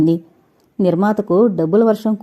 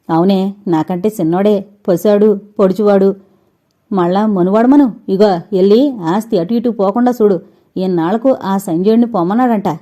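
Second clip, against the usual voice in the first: plain reading voice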